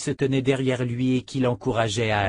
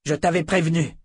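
higher, mildly growled reproach; grognement de reproche; 6 semitones higher than usual. A narrator's reading. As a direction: growl it as an irritated telling-off. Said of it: "grogna Fitch". (grogné, sur un ton de reproche)

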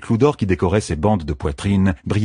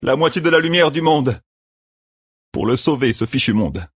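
higher, fierce and reckless; Matt's defiant vow; 7 semitones higher than usual. A narrator's reading. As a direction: throw the offer down like a bet. Matt continues his vow.

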